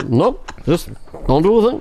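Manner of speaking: lightly voiced Obama, calm and unbothered; Obama impression. Obama calmly telling his staff to do nothing.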